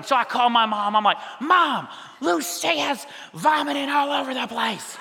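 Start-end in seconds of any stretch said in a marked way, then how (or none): 1.4-5.0 high-pitched mock panic on the phone; panicked new dad. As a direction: wail to mom for help, hammed up.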